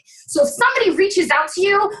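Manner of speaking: normal talking voice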